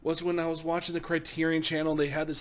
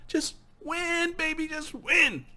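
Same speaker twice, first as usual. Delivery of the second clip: high cheering shout; yelling encouragement at the screen; 11 semitones higher, slower than usual. Retold or imitated. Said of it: "I was like".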